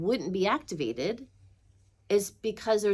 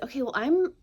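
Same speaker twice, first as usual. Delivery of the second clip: higher-pitched, decided announcement; resolved declaration; 8 semitones higher, 4 dB louder than usual. Retold or imitated. Speaker telling guides they are ready, resolved. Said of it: "And I said".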